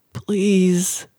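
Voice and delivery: drawn-out, restrained begging; desperate plea. A narrator's reading. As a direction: beg on one long word. Desperate mother prays for her son.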